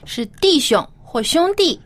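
usual speaking voice

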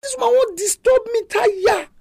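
a worn-out judge complaining in a high, put-upon voice; exasperated judge. Retold or imitated. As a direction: whine like a judge who has had enough. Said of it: "this judge come tire".

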